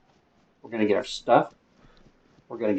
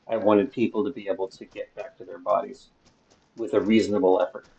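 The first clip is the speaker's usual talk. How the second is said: ordinary talk, nothing marked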